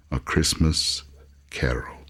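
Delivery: ordinary delivery, nothing marked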